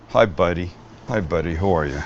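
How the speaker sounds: low, soft hello to a little buddy; gentle greeting. Real, not acted. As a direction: coo quietly at a small friend. Greeting a pet or child.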